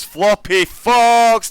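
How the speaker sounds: the name drawn out high and loud; excited call. Real, not acted. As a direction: stretch the name out in a rising shout. Calling name in tight qualifying.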